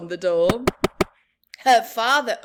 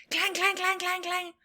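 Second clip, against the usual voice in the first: alarm bell; 7 semitones higher, faster than usual. Acted. Fire alarm ringing.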